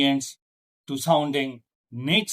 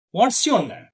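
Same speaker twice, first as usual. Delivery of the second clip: WH-question with the pitch falling on name; high onset, falling away through name; falling question intonation; 7 semitones higher, 3 dB louder than usual. Shown as a demonstration. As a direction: start high and drop on name. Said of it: "falling intonation".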